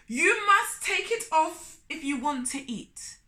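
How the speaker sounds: high, loud insistence; firm Turtle. Acted. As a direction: insist he takes it off. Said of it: "said Turtle".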